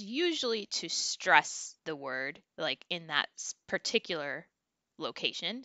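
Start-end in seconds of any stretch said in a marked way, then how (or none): none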